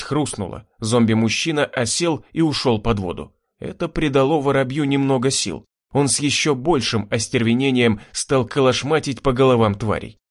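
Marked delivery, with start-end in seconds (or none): none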